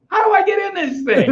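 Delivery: high, loud mock-helpless whine; exasperated plea. A novice begs his coach for help, exasperated high cry.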